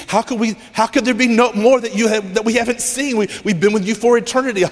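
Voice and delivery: acted-out bewildered questioning; baffled angels. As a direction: stumble over the words in incredulous objection. Acting out baffled angels objecting.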